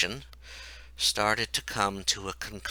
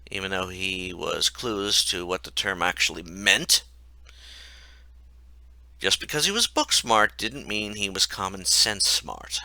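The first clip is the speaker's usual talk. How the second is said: plain reading voice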